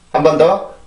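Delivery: normal talking voice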